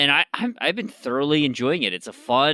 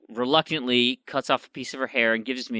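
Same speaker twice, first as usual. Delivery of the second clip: ordinary talk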